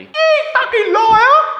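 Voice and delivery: very high, slow, laughing Australian voice; mock Australian imitating Millie, high and drawn out; Australian accent. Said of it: "I need to do an Australian accent for Millie".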